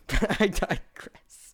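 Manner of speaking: slow, soft laughing "I digress"; chuckling aside. Real, not acted. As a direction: let it slip out through a soft laugh, slowly. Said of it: "But I digress".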